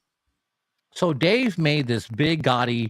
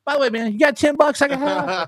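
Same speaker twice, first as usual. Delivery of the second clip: much higher, casual mock voice; stranger asking for money; 12 semitones higher than usual. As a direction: slip the ask in high and breezy. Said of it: "the guy goes".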